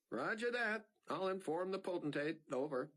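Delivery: high, crisp operator reply; radio operator. A narrator's reading. Operator replying to pilot over radio, crisp.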